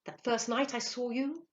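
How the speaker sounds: high, eager question; begging. A narrator's reading. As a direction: plead urgently to be told. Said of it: "he begged".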